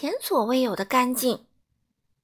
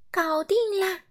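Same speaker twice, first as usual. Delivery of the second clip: much higher, pleased; triumphant; 9 semitones higher than usual. A narrator's reading. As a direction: announce it proudly. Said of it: "干净先生说". (高声、得意地说)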